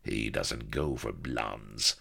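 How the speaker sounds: low, soft, slow villain; snarled. A narrator's reading. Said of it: "snarled Akbar".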